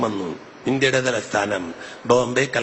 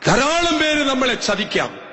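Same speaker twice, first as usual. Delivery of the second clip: high, loud, slow cry; preaching shout; 13 semitones higher, 3 dB louder than usual. Acted. Preacher shouts a warning to the congregation. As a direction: cry it out over the crowd.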